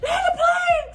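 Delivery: high, loud, panicked yelling; frantic shouting. Retold or imitated. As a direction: scream it like you think the plane is going down. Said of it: "stayed back there yelling".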